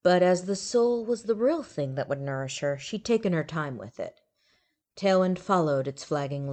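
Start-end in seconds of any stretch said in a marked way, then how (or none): none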